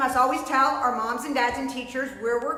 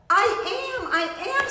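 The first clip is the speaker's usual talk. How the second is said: high, urgent cry of I am; a silent shout he cannot get out; wanting to shout; 10 semitones higher, much faster than usual. A narrator's reading. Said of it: "Sylvester wanted to shout".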